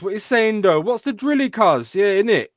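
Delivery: high, hammed-up London roadman slang. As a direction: do a fake tough London gangster. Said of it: "You ever heard a roadman speak".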